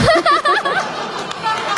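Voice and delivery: high laugh. Real, not acted. Laughing at odd radio name.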